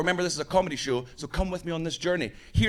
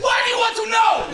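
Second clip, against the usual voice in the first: high, loud burst of suspicion; mock panicked shout; 8 dB louder than usual. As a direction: imitate a paranoid audience member yelling. Imitating suspicious Russian audience member.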